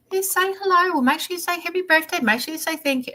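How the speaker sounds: put-on high, bright-toned coaching of a child; prompting child. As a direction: coax the boy to say his manners. Mum prompting son to greet.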